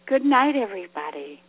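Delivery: ordinary delivery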